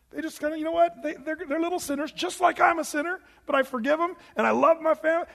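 put-on sweet old man. Preacher voicing a forgiving great-grandfather, lifting the pitch high and sweet.

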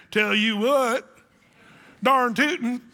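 mock country drawl, slow and loud; backwoods Southern drawl. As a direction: play a backwoods good ol' boy. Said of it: "if they're talking like that".

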